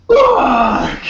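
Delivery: high, loud drawn-out mock shout; imitated shout. Imitating the angry downstairs neighbor to a friend, full shout. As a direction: bellow it like the angry guy downstairs. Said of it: "this guy just yelling".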